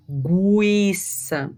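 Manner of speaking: GUIÇA drawn out as GWII-ça; U sounded in GUIÇA. Shown as a demonstration. Teacher demonstrating the sound of the U, GUIÇA drawn out as GWI-ça. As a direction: stretch "Guiça" with an audible W after the G. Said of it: "e o som do U".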